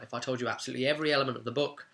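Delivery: ordinary delivery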